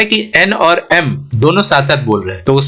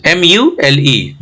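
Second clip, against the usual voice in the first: slow spelling; much slower than usual. Shown as a demonstration. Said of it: "spelling out letters".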